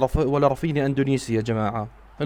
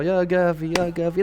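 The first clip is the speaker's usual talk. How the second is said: usual speaking voice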